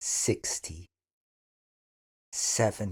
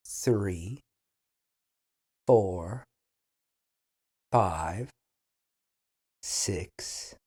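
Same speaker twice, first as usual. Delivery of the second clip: careful counting drill; 4 semitones lower, slower than usual. Shown as a demonstration. Teacher counts aloud for students to listen, slow and deliberate. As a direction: count slowly for beginners to copy. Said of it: "just listen".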